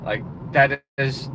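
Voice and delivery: usual speaking voice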